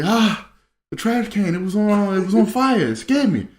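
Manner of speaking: put-on high, scared kid's whimpering explanation; mock crying. Crying boy explains the fire to dad, scared. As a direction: wail it like a frightened child, acted. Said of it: "Get in the house, I'm crying".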